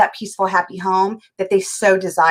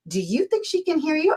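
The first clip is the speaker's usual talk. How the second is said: higher, softer mock query; retold teasing question; 6 semitones higher, 5 dB quieter than usual. Husband to wife yelling at TV, retold teasing. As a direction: imitate a husband's pointed little question.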